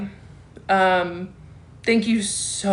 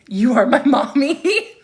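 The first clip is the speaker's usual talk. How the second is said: loud, higher, slow laughing read-out; 6 semitones higher, 6 dB louder than usual. Real, not acted. Host reading a fan's comment aloud, laughing. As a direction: laugh through it, drawn out and rising.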